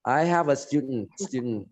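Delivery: American "student" ending in a bare n; slow American sentence, "student" as styud-n; American accent. Shown as a demonstration. Teacher imitating an American speaker, American accent. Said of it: "gitu ya dia ngomong".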